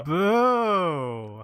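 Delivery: one long swooping boo; mock jeer, rising high and sliding down; playful booing. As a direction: heckle with a drawn-out boo. Host jeers the excuse again.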